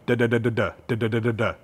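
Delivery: rapid staccato da da da; machine gun. Shown as a demonstration. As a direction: fire off the das like bullets. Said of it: "like you're shooting a machine gun".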